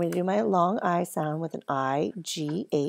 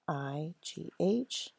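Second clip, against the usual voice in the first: "I G H" spelled slowly; slow spelled letters; 10 dB quieter, slower than usual. Shown as a demonstration. Teacher spells the letters slowly for first graders. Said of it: "change it to a long sound".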